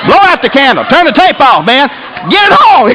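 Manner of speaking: high, frantic orders; hollering. Retold or imitated. Angry dad calling off communion, hollering. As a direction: imitate a man barking out orders in a fit. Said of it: "I'm hollering, stomping".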